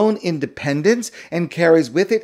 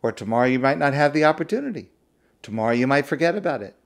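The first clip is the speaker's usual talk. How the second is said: usual speaking voice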